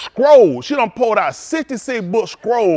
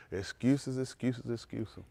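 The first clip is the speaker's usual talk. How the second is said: each excuses softer and lower; fading repetition; 8 semitones lower, 10 dB quieter, much slower than usual. Real, not acted. Preacher dismissing excuses, fading softer. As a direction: let it fade away, slow.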